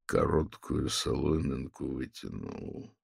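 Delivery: soft, slow murmur; barely audible. A narrator's reading. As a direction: say it almost under your breath. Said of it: "едва слышно проговорил Педро".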